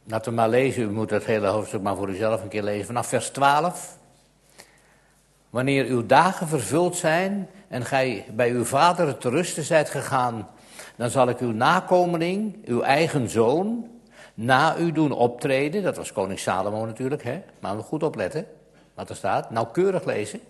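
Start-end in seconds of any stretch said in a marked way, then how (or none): 5.5-15.8 raised, solemn reading of scripture; solemn Bible reading. Acted. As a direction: read it out like a preacher from the pulpit. (plechtig voorgelezen bijbeltekst)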